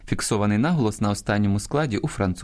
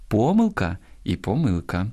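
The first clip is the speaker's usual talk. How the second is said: помилка first stressed on ПО-, then on -МИЛ-; double stress. Shown as a demonstration. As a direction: show both stresses of помилка, first syllable then second. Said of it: "мають подвійний наголос". (два наголоси: помилка на першому, потім на другому складі)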